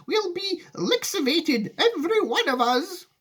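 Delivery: high, drawn-out wail of doom; alarmed cry. A narrator's reading. Grandma predicting doom for everyone, high drawn-out wail. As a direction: wail it out slowly. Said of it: "cried Grandma Georgina".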